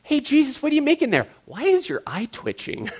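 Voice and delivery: high-pitched, wary mock questioning; nervous disciples. Disciples watching Jesus weave the whip, high and nervous. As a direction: ask it high, as if treading carefully. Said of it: "What's going through the disciples' minds".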